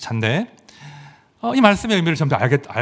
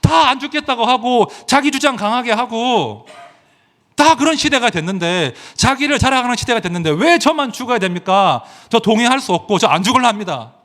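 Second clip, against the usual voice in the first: higher and louder, a mock tantrum at God; acted frustrated protest; 8 semitones higher, 3 dB louder than usual. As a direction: pretend to argue back with God. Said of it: "너무 성질이 나는 거예요".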